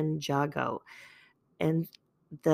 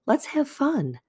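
bright jump, much higher, upbeat; higher, peppy let's-have-fun burst; sudden excitement; 8 semitones higher than usual. Pretend. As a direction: switch to bubbly enthusiasm. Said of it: "I like how I went from".

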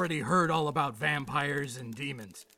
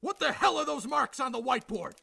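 raised, furious scolding; angry; 7 semitones higher than usual. Acted. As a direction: chew out a subordinate. Chief berates officer over escape.

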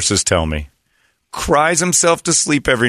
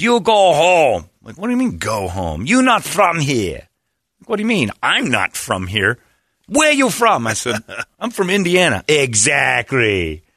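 Korean accent impression; 6 semitones higher than usual. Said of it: "that Korean guy".

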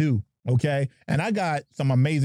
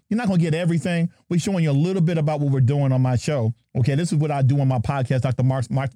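usual speaking voice

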